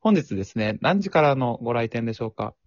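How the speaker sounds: high, bright polite clerk; chirpy front-desk phone manner; customer service voice. Pretend. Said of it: "店員さんのテンション".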